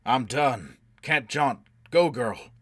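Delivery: loud, forceful bursts; urgent shout. A narrator's reading. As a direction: shout her away in short urgent phrases. Injured, urging her to flee.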